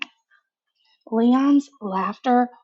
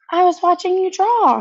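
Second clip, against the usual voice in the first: little sister; 8 semitones higher, 4 dB louder than usual. A narrator's reading.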